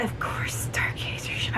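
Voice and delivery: voiceless hushed whisper; a conspiratorial whisper naming Stargazer; whispered aside. Retold or imitated. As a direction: drop to a secretive whisper. Secret aside in quote.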